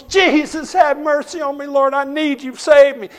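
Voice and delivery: preacher retelling Matt crying out to Jesus for mercy by the roadside; desperate cry. Retold or imitated. Desperate man cries out to God.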